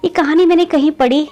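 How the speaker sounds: ordinary delivery, nothing marked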